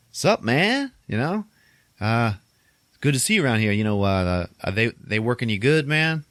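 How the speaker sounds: casual greeting. Retold or imitated. Visitor greets groundskeeper splitting wood, high casual small talk. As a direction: make small talk with lifting question endings. Retelling awkward friendly greeting.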